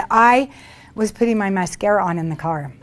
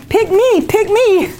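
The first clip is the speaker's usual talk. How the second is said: put-on very high, loud childlike "pick me"; eager pick me; 12 semitones higher, 5 dB louder, slower than usual. Voicing a spirit wanting the heart link, squeaky eager plea. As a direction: beg to be chosen like an eager kid. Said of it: "It's like saying".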